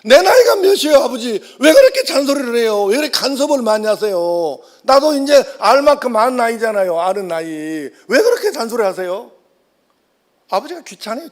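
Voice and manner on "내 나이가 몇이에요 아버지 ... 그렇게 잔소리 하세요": much higher, loud exasperated whine, 나이가 peaking and falling; indignant son. Retold or imitated. Second son shouting back at nagging father, high and loud. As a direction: burst out like a son sick of nagging. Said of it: "이게 둘째 아들이에요".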